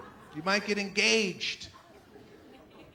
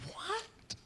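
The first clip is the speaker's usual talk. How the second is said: shocked friends; 12 semitones higher, 12 dB quieter than usual. Retold or imitated. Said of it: "They're like".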